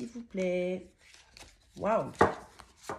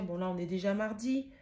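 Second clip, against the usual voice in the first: normal talking voice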